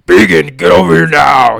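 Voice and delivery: imitating a stressed officer yelling over the radio; deep, grunty authoritative shout. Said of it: "deep, grunty, like, authoritative voice".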